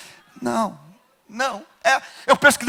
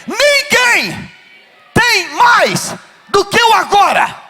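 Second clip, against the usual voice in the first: shouted call to repeat; 9 semitones higher, much slower than usual. Shown as a demonstration. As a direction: lead the church, stretching each word. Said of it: "Diga assim".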